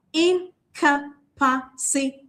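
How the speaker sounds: "incapacitate" slowly in five parts, in-ca-pa-ci-tate; syllabified. Shown as a demonstration. Teacher syllabifying for the class, "incapacitate" as in... ca... pa... ci... tate. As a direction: pause between each syllable of "incapacitate". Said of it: "once again to syllabify".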